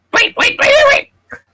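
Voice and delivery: very high, loud squeaky creature voice; frantic high-pitched squeaks; cowering shriek. Acted. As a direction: squeal it like a tiny creature pleading for its life. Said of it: "it sort of shrieks and cowers".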